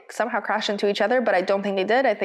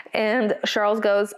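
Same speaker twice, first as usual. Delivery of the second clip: usual speaking voice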